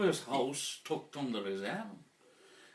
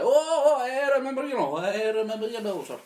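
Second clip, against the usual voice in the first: high, loud eager shopkeeper voice; 12 semitones higher, 11 dB louder than usual. Acted. Shopkeeper greeting a returning customer, high and eager. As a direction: play the fawning seller remembering his customer. Shop seller recalling the skull.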